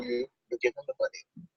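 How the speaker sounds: whisper. Retold or imitated. Dad instructing daughter at counter.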